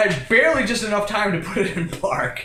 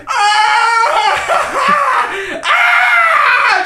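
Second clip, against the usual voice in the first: very high, loud, drawn-out wail; hammed-up agonized shriek; screaming in agony; 8 dB louder than usual. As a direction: howl with pain, full force. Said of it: "Just screaming in agony".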